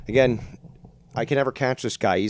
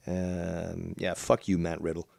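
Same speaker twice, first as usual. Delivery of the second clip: ordinary talk, nothing marked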